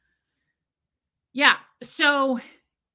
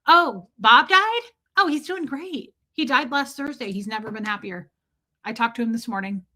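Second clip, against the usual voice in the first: mock cheerful nonchalance; 3 dB louder than usual. Mimicking OT eights who shrug off a friend's death. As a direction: play it breezy, like nothing happened. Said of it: "They go".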